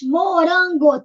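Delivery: MORANGO split evenly, MO, RAN, GO; syllable by syllable. Shown as a demonstration. Teacher counts the giraffe's food, syllable by syllable. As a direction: say MORANGO in three distinct beats. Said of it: "três".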